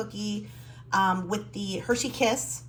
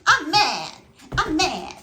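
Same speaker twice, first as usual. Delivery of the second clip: pouty dog voice, higher and louder, with laughs; voicing the dog; 8 semitones higher, 7 dB louder, slower than usual. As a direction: play the grumpy pug, half laughing. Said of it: "It's like".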